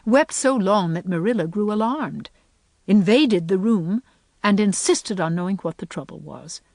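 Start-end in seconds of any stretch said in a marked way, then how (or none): none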